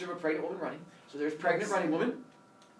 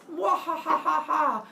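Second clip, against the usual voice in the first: high, hammed-up villain cackle; evil laugh; 10 semitones higher, 4 dB louder than usual. Hera watching Leto flee, laughing evilly. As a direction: ring out a mock wicked laugh. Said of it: "Hera laughs evilly".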